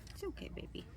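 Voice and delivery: soft whisper, reassuring; whispering to a scared dog. Real, not acted. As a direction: hush a frightened puppy gently. Calming puppy during handling.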